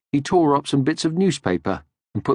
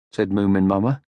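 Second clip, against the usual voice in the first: ordinary delivery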